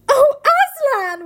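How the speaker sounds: loud, high, drawn-out wail; shrill cry instead of whisper. Acted. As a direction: shriek it where a whisper belongs. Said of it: "whispered Susan".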